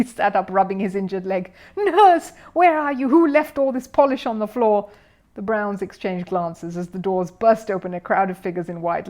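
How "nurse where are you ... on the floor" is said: high, loud indignant complaint; angry shouting. Acted. Mister Curry complaining about the polish, angry shout. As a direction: demand answers across the ward.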